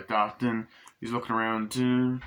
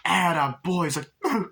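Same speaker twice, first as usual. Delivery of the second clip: proud praising voice; 7 semitones higher than usual. Retold or imitated. Chucky praises Glenn.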